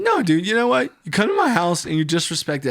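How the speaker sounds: high, loud hurt dude voice; mock wounded friend. Hurt friend complaining about his home, high and aggrieved. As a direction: whine about being disrespected.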